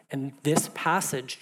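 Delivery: ordinary delivery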